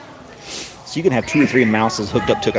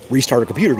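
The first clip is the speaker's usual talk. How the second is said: ordinary talk, nothing marked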